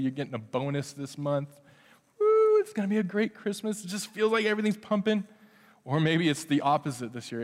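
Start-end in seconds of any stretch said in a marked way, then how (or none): 2.2-5.2 higher, brighter imitation of a happy churchgoer; mock excited cheer. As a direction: toss off a celebratory whoop. Imitating a listener getting a bonus.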